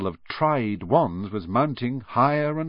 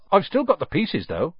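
higher, cheerful lift; brightly; 9 semitones higher than usual. A narrator's reading. Hagrid adds it with a mild bright lift. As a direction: perk up, a touch cheerful. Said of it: "he added brightly".

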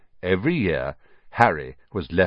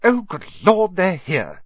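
high, louder flustered cry; frantic; 11 semitones higher, 4 dB louder than usual. A narrator's reading. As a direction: blurt it in a panic. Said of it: "said Aunt Petunia frantically".

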